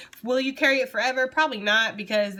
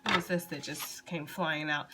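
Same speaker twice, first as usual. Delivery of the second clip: ordinary delivery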